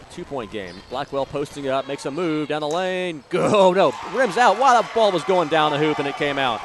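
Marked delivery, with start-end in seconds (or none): 3.3-6.6 loud, disbelieving commentator cry; excited near-miss call. Real, not acted. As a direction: call it louder, astonished the ball rimmed out.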